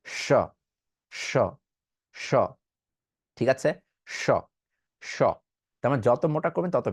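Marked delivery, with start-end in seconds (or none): 0.0-5.2 স held as a thick, hushing sh; thick sh sound. Shown as a demonstration. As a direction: make the sh as thick as you can. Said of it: "ওই সাউন্ডটা মোটা যত করবেন". (মোটা করে শ)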